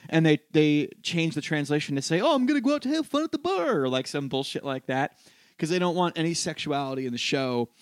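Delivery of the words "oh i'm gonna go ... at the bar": mock censor voice. Censored translation mocked.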